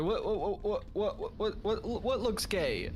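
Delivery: lightly voiced confused stammer; mock confusion. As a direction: play the baffled guy. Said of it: "He's like".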